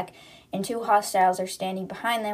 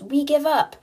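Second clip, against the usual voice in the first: higher, louder mock yell; retold yell, pitched up; yelled surrender; 6 semitones higher, 5 dB louder than usual. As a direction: call out the surrender in a raised voice. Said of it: "Anna yells".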